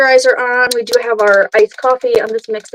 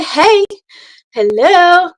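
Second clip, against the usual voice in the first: sing-song greeting; 6 semitones higher, 3 dB louder, much slower than usual. Shown as a demonstration. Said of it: "you can say".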